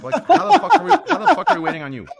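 high, loud shout at a latecomer; full-volume shouted demand; exasperated yelling. Real, not acted. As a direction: yell it in blustering disbelief. Mock outrage at late co-host.

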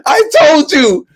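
high, loud vindicated shouting; triumphant yelling. Retold or imitated. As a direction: yell "told you so" to friends across a stage. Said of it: "I'm yelling at my friends on stage".

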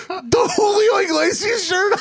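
high, slow, laughing cry of disbelief; squealing through laughter at the absurd accusation. Retold or imitated. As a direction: crack up as you say it.

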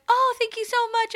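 sweet high voice, politely gushing; high gushing thank-you; high imitation of host. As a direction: lift the pitch way up, bright and gracious.

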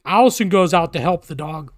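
usual speaking voice

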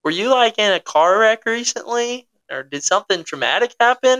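retold bewilderment, lifted high; confused doctor. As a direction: ask it like a baffled doctor. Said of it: "super confused".

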